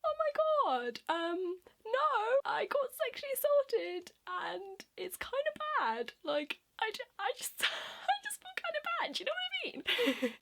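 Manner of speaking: very high, squeaky distress; acted near-tears babbling. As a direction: squeak it out, mock-falling apart. Acts herself breaking down.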